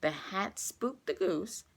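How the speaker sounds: ordinary delivery, nothing marked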